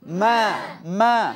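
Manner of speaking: ম্যা drawn slow and light; thin মা. Shown as a demonstration. Teacher models the thin মা for viewers. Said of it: "মা পাতলা হরফ".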